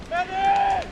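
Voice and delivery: high strained call for a medic; man down shout. Real, not acted. Shot in game, calling medic.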